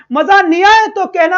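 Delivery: teacher loudly challenging his students; emphatic promise. Real, not acted. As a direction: shout the promise out with conviction.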